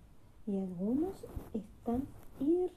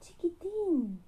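calling out; 6 semitones higher, much slower than usual. Acted. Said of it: "grita Elefanta".

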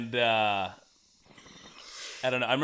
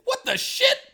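shocked exclamation; 5 dB louder, slower than usual. Retold or imitated. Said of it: "He's like".

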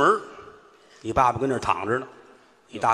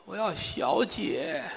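put-on feeble old man; 5 semitones higher, 7 dB quieter, much slower than usual. Dying old man asks weakly.

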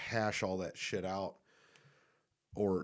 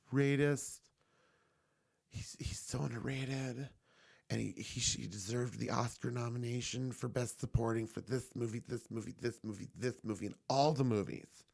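mock gushing fan; 2 semitones higher than usual. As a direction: gush like a devoted fan, sending them up. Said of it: "people out there that think Paul Dano is just".

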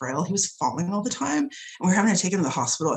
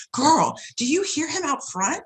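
higher, slow, drawn-out gossipy question; imitated women customers; 7 semitones higher, slower than usual. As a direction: lightly act out a startled customer. Voicing the startled older women.

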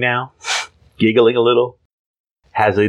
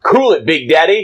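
put-on hip teen voice; 7 semitones higher, 3 dB louder than usual. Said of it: "Jimmy Olsen saying".

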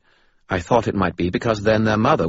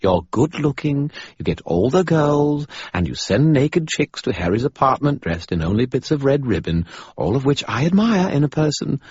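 Bob patronizing; 10 semitones higher, 5 dB louder than usual. Acted. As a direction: talk down to him like a child. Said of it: "its tone patently patronizing".

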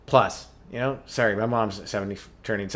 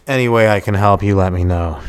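mock flirting; 5 semitones lower, 9 dB louder than usual. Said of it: "I didn't say it like that".

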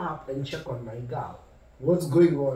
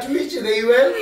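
ordinary talk

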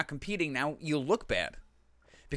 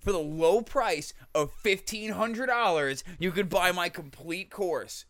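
higher, slow pitchman voice; infomercial; 5 semitones higher, 3 dB louder, slower than usual. Retold or imitated. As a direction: pitch the price like a TV ad. Parody sales pitch.

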